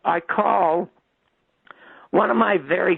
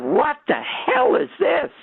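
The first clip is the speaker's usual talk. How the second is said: retold scream; 10 semitones higher than usual. Storyteller screamed at the set, high mock yell. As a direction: reenact screaming at the TV, pitched way up. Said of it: "I screamed at the set".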